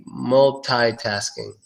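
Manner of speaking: "multitasking" slowly, as mul-TIE-tasking; MUL-TIE variant. Shown as a demonstration. Teacher offers one option, multitasking as mul-TIE-tasking. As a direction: say "multitasking" drawn out with an "eye" vowel. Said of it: "You can say".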